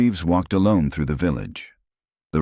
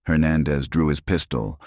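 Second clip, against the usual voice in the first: plain reading voice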